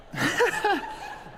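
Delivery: a high burst of real laughter; real laughter, cracking up at his own story. Real, not acted.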